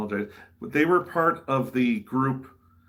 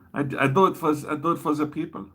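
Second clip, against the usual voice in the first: put-on higher-pitched comic German accent; mock German accent; 7 semitones higher than usual. Performer modestly tells fans he does it for the people, mock German accent. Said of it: "when I do a German accent".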